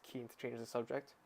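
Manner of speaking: ordinary delivery, nothing marked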